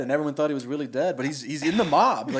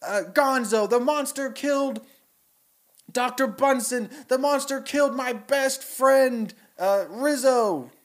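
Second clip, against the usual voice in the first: higher mob accusation, mildly raised; rally the crowd, a touch hammed-up; angry mob voice; 9 semitones higher than usual. Villager mob accusing the monster at the wedding, high hammed-up shout. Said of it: "they're like".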